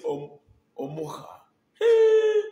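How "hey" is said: very high, very loud hail; hailing his chairman with a shout; excited shout. Real, not acted. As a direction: yell it out in joy. Hailing a viewer.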